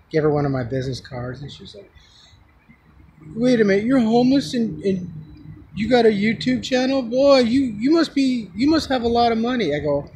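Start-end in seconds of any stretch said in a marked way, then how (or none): 3.4-9.7 high-pitched incredulous retelling; imitating amazed woman. Woman learns homeless man has YouTube channel, imitated amazement. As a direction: mimic her disbelief in a raised voice.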